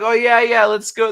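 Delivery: high, loud and eager; mock excited yes; mock eager friend. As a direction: ham up a friend keen to go out. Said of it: "People be like".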